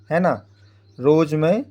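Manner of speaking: normal talking voice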